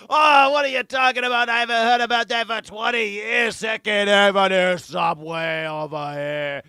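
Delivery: loud, high cartoon New York accent, falling and stretching at the end; Donald Trump. Host mocking the president's answer about the subway, cartoon New York accent. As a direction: holler like an exaggerated New Yorker. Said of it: "like, gets his New York accent back".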